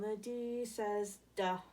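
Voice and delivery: "duh" drawn out slowly; slow D sound. Shown as a demonstration. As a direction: teach "duh" slowly to children. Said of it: "All the letters make a sound".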